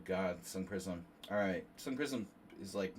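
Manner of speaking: usual speaking voice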